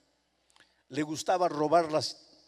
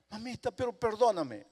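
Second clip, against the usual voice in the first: pleading; 6 semitones higher than usual. Acted. Boy begs mother again.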